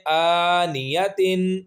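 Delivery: آنیتن with the tanween ending sounded; very slow full reading. Shown as a demonstration. Teacher reading without the stop first, very slowly. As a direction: read آنیتن slowly, voicing تن at the end. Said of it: "پہلے وقف کے بغیر پڑھتے ہیں".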